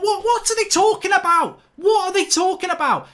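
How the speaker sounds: a fan raging at the referees; furious disbelief. Real, not acted. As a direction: demand it angrily, twice over. Angry rant at the refs.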